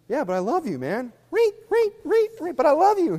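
hammed-up high chirp of false love; mock backstabber.

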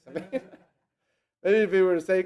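normal talking voice

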